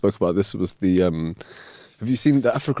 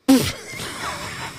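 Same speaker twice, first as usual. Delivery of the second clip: soft giggle; 13 semitones higher, 5 dB quieter than usual. Real, not acted. Laughs after own joke.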